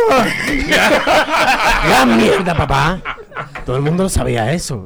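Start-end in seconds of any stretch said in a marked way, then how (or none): 0.1-1.8 carcajada gritada; un ah entre risas fuerte y agudo; grito riendo. Real, not acted. Grito de risa. 1.9-3.0 grito fuerte entre risas; grítalo riéndote, burlón. Real, not acted.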